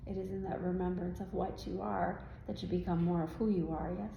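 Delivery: ordinary delivery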